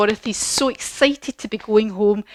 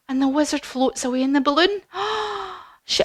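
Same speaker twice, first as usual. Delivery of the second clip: ordinary delivery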